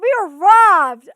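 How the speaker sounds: loud, slow, furious declaration; real anger, loud and drawn out; angry outburst. Real, not acted. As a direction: spit it out, genuinely robbed. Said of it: "I'm so mad".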